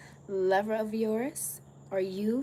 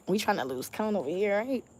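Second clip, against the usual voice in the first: ordinary talk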